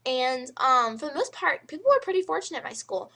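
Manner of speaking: ordinary talk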